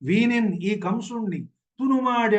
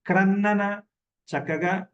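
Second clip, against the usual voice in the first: usual speaking voice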